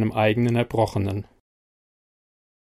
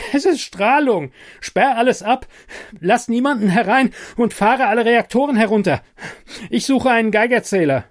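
much higher and louder, frantic orders; urgent shouting; 10 semitones higher, 4 dB louder than usual. A narrator's reading. Ordering Bernard to lock down and shut reactors, frantic. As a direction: bark the orders in a panic.

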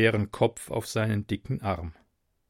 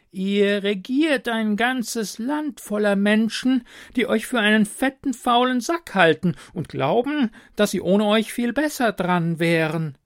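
higher, deliberate adviser voice; blunt royal adviser; 9 semitones higher, 4 dB louder than usual. A narrator's reading. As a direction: deliver bad news dryly.

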